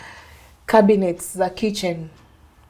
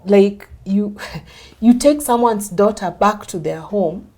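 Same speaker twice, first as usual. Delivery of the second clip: stern bishop; 7 dB louder than usual. Retold or imitated. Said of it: "the bishop asks him".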